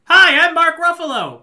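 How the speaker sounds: high, loud imagined natural voice; exaggerated squeaky-high introduction; Mark Ruffalo. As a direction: pitch it way up and belt it out. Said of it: "his normal speaking voice would be like".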